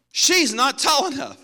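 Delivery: high, loud gleeful tattle; mock tattling brother. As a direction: sell the sister out with glee. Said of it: "how's that for selling your sister out".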